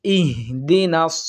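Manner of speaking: ordinary delivery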